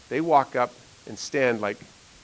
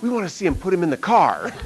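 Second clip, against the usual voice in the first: eager classmates; 6 semitones higher, 5 dB louder than usual. Retold or imitated. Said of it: "they're like".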